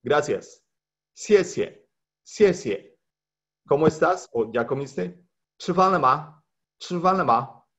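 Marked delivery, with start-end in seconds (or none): 1.1-3.0 xie xie said high with a falling tone; Mandarin review. Shown as a demonstration. Teacher reviewing xie xie for the class, high falling. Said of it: "voy a repetir la pronunciación". 5.6-7.7 Chi fan le ma said high, with a falling tone on fan; Mandarin review. Shown as a demonstration. Teacher reviewing Chi fan le ma for students, high pitched. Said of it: "voy a repetir la pronunciación".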